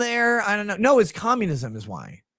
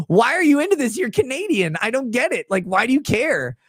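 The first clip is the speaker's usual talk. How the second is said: retelling a baffled reaction to a friend, raised pitch; incredulous disbelief; 6 semitones higher than usual. Retold or imitated. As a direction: act baffled that a Canadian cares. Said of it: "And I'm like".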